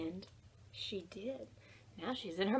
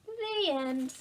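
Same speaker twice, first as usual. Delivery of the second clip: usual speaking voice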